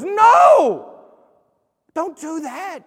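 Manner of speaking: loud, high pleading no; urgent plea. Acted. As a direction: cry out to stop God. Abraham pleads with God.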